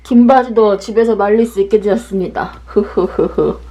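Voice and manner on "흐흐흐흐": evil chuckle. Real, not acted. Giggle after drying clothes.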